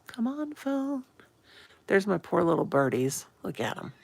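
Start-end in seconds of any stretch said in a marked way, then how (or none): 0.1-1.0 much higher pleading to the phone; high whiny plea to the phone; high coaxing plea. Real, not acted. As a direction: coax the slow phone in a high voice.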